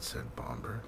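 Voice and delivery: plain reading voice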